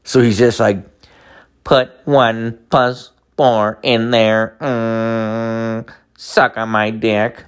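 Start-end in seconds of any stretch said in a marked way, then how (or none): 1.6-7.4 robotic computer-synthesised voice; slow, monotone speech-machine impression, word by word; Stephen Hawking. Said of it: "He can't even talk".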